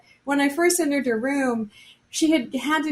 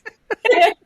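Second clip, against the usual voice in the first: laughing; 11 semitones higher, 8 dB louder than usual. Real, not acted. Laughing at own joke.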